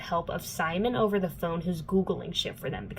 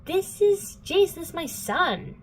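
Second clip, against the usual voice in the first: much higher, slow, sing-song "This is Jace"; cheesy villain greeting; 11 semitones higher, slower than usual. Retold or imitated. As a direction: play the villain as a smug, chirpy host. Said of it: "Valentine shows up and he's like".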